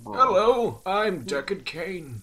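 put-on high then very slow old man; quavering old man greeting. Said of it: "best old man voice".

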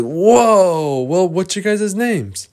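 high, stretched-out wonder; amazed. Acted. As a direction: gasp it out high, in awe. Boys amazed by talking unicorns.

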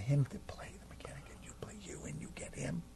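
quick, hushed whisper; whispered aside. Real, not acted. Host privately offering to swap roles, whispered aside. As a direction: whisper a hurried suggestion.

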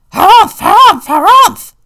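high, loud huffy bird squawk; yelling bird. A narrator's reading. Bonga bird yelling about the squirrels, high squawk. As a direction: puff up and squawk in outrage. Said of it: "yelled a bonga bird".

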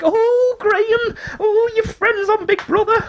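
put-on very high, drawn-out old-lady holler; mock old dolls. Teller mimics old dolls from across the road, mock old dolls. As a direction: imitate old dears cooing in a squeaky falsetto.

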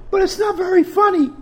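high put-on heckling voice; mock heckler. Host heckling his own show in a high put-on voice. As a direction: jab at the host in a squeaky voice.

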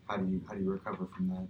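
ordinary delivery

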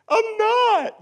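put-on high, loud childish "I'm not"; little girl's denial. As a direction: squeal it like a stubborn little girl. Daughter's high denial, voiced.

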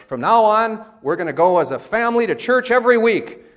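high, loud parental decree; dad's pronouncement. Acted. Dad announcing a rule at the dinner table, emphatic. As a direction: lay down the family rule emphatically. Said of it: "Dad makes some sort of pronouncement".

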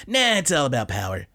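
a touch of a scoffing second voice in his head; lightly voiced inner dissenter brushing him off; dissenting inner voice. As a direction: toss it off as the stubborn inner voice. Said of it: "in his head, he says".

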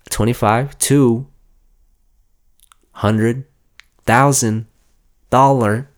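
slow, rising toward a loud peak; building reveal. Acted. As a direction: build it up, climax on the number. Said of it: "drawn-out reveal of a price".

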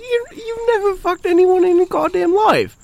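high, loud, outraged mock Brit; hammed-up British accent. Shown as a demonstration. Showing off in accent contest, shrieking British outrage. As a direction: squawk indignantly in an exaggerated British accent. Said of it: "who has a better actually British accent".

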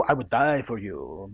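low, soft crooning suitor; romantic accent. Retold or imitated. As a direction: lay on the charm, low and smooth. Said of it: "You need to perfect that accent too".

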